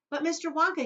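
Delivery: high, loud eager shout; boy shouting at Mister Wonka. Acted. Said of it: "he shouted".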